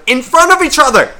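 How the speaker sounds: high, loud exasperated cry; ranting, voice jumping way up; outraged emphasis. Real, not acted. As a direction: hit it hard in disbelief. Outraged climax of the rant.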